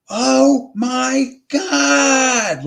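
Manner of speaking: high, drawn-out wail; imitated little girl's drawn-out cry. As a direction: stretch each word out high and loud, imitating a cry. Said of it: "like that, but it's a little girl".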